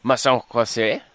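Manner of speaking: mock French phrase in a put-on accent; fake French. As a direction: faux French, hammed up. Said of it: "speaking fake French".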